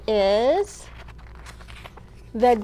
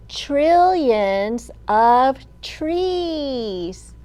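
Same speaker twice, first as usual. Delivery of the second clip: drawn-out title, each word stretched; slow title read; 4 dB louder, much slower than usual. Shown as a demonstration. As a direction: announce the book title slowly. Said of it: "Will you spell the word trees with me".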